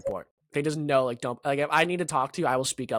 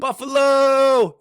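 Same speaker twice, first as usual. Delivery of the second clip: long, high, loud holler with the last syllable held; drawn-out imitation bonus shout; slot-machine scream; 11 semitones higher, 10 dB louder, much slower than usual. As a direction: belt it out like a jackpot machine. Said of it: "it just screams Buffalo at you".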